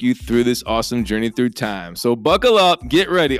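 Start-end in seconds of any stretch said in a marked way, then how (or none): 2.1-3.4 pumped and louder, pitch up; rally the class like a ride; hyped-up call. Acted. Hyping class for the lesson.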